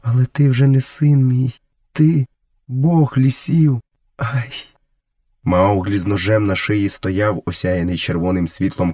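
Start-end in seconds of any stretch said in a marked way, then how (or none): 0.0-4.7 awed, higher-pitched bewilderment; bewildered woman, ending in a small cry; confused murmur. A narrator's reading. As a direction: voice dawning awe, slowing at the end. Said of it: "бурмотіла вона збентежено".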